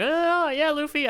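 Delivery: lightly voiced high-pitched cheerful quote; mock character voice. Hosts retelling a character's reply, lightly imitated in high pitch. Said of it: "he's like".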